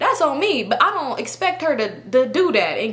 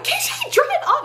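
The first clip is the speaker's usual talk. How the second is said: very high imitation of a frantic cry; mock panicked yell. Friend urging the driver to go, high mock panic. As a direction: imitate a friend squealing an urgent order.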